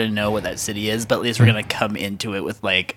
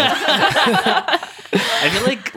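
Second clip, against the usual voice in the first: laughing; 14 semitones higher, 3 dB louder, much slower than usual. Real, not acted. Laughing while suggesting.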